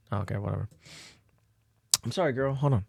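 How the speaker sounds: ordinary delivery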